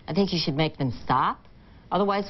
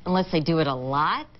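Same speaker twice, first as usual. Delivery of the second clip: ordinary delivery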